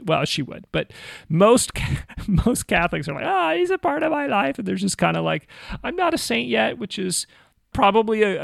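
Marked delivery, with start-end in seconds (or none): 3.3-4.6 much higher, mock bashful voice; imitating bashful Catholics. Imitating modest Catholics deflecting. 5.8-6.8 mock a humble believer, pitched up; imitated humility. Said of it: "good, humble in-, instinct".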